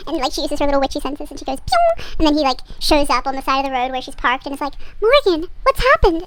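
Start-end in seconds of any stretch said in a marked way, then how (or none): 1.6-1.9 playful spoken magic-signal sound; imitated zap noise. Imitates sending psychic signal. 5.0-6.3 high, slow melodramatic concern; louder mock-worried hero's question; concerned boyfriend. Boy arrives, concerned.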